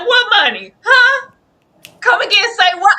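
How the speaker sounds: mock Tom. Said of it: "'cause Tom said".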